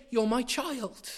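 slow, gentle reassurance; tender voice of God. Acted. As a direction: say it like a father to his child. Voicing God's tender words.

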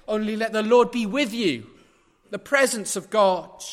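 loud, ringing pulpit voice; preaching at full force. Acted. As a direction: proclaim it to the whole congregation. Loud scripture reading and preaching.

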